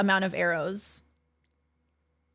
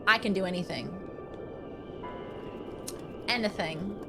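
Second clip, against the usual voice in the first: usual speaking voice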